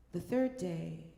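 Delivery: ordinary delivery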